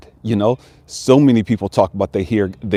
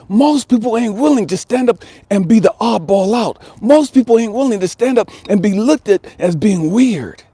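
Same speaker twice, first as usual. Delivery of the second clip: fired-up sermon to the family; preaching; 9 semitones higher than usual. Acted. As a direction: preach it with growing intensity, pitch climbing. Builds into a sermon-like exhortation.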